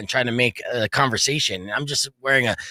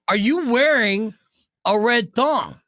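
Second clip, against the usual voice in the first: higher, slow, each word spaced; slow deliberate repeat; 8 semitones higher, 3 dB louder, slower than usual. Retold or imitated. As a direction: ask again slowly to make him admit it. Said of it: "I go".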